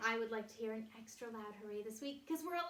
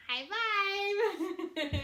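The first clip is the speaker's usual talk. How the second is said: loud, high high five; excited cheer; 10 semitones higher, 12 dB louder than usual. Acted.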